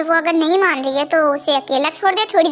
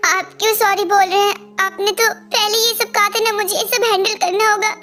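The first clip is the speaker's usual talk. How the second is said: usual speaking voice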